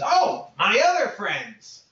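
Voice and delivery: high, slow goblin call to friends. Acted. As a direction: wave them over happily. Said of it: "and he goes".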